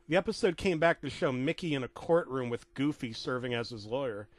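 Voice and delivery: plain reading voice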